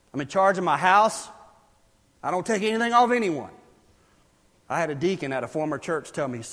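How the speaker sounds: ordinary talk, nothing marked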